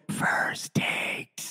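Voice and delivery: playful hushed whisper. Pretend. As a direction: whisper it, teasing the host. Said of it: "need a sound effect".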